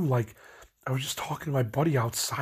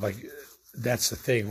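ordinary delivery

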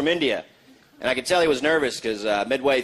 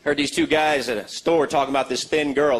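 ordinary talk

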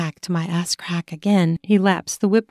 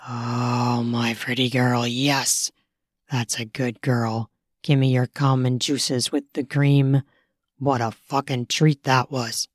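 lower, softer man's voice; low praising lover; 7 semitones lower, 4 dB quieter than usual. Acted. Male lover praises her.

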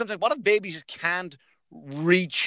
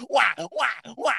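imitating a baby cry; 8 semitones higher, slower than usual. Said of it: "the only thing a baby can do is".

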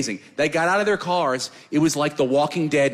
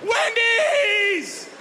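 put-on very high, hoarse-free drunken scream; much slower than usual. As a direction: scream the name like a caveman's first word. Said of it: "I really mean I screamed".

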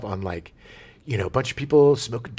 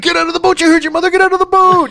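high, loud parental yell; shouting; 10 dB louder, faster than usual. Retold or imitated. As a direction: yell it at the kid, full force. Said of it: "he's like".

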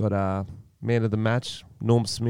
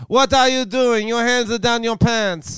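put-on high, loud Kazakh Borat accent; Borat impression; 12 semitones higher, 4 dB louder than usual. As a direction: shout it as Borat, pitched way up. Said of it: "I'm running as Borat".

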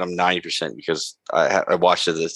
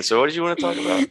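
much higher, fast chirpy voice; chirpy Ashley; 11 semitones higher, faster than usual. Retold or imitated. Ashley greets A-Train brightly, high fast voice. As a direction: rattle it off brightly. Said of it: "All right, A-Train".

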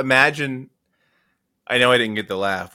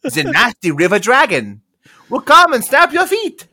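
high, loud cartoonish guide voice; playful tour guide; 10 dB louder than usual. Quizmaster imagining a guide warning of crocodiles, high and loud. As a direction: ham up a fussy fjord guide.

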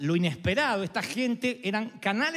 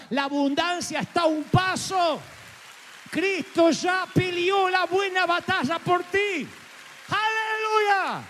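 high, shouted preaching climax ending in a long hallelujah; preaching at full force; 9 semitones higher, slower than usual. Real, not acted. Pastor proclaiming victory to his congregation, shouted preaching. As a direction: build to a triumphant cry.

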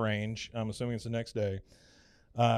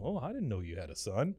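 soft, subdued reply; mild Hank; 7 dB quieter than usual. Retold or imitated. Hank learning of his boss's son, soft and mild. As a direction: answer quietly, politely puzzled.

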